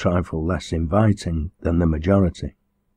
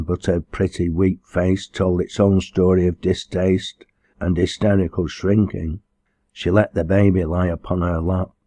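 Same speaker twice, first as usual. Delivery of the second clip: plain reading voice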